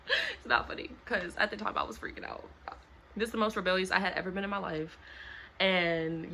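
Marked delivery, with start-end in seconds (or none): 0.1-0.9 high squeal of laughter through the words; can't stop laughing while saying it; giggling protest. Real, not acted. Laughing at own story.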